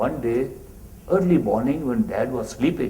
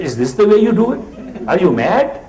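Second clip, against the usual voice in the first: much higher and louder scolding; stern rebuke; 7 semitones higher, 7 dB louder than usual. Retold or imitated. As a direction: scold him in disbelief. Master scolds the disciple.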